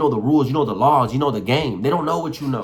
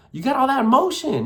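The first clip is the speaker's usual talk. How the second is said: usual speaking voice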